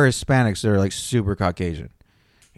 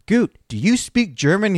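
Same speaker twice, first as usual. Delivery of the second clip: retold question pitched high and slow; high mock question; 10 semitones higher, 4 dB louder than usual. Retelling the Navy story, asking shipmate Goot, high slow voice. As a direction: ask it up high, slow and pointed.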